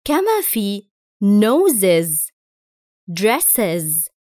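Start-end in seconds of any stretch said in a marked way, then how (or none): 1.2-2.3 noses ending in an iz syllable. Shown as a demonstration. Teacher shows the es plural ending to students, noses with iz. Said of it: "نلاحظ أنها تنطق is". 3.1-4.1 dresses ending in an iz syllable. Shown as a demonstration. Teacher shows learners the es plural ending, dresses with iz. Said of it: "نلاحظ أنها تنطق is".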